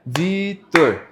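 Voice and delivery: "Vitor" split slowly into Vi, tor; syllable by syllable. Shown as a demonstration. Teacher introducing himself to small children, "Vitor" split into slow syllables. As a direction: say "Vitor" in two drawn-out, separated syllables. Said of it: "eu faço essa separação de sílabas".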